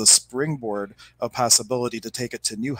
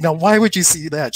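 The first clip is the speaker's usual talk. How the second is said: upset retort; 5 semitones higher, 6 dB louder than usual. Retold or imitated. Woman crying, upset at reader.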